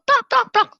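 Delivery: high, loud staccato stomps; three sharp imitated stamps; stamping sounds. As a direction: voice each stomp hard and high.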